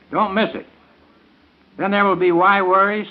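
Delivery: ordinary talk, nothing marked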